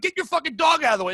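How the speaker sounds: fast, high, exasperated retold demand; angry retort. Runner ordering dog owners aside, angry.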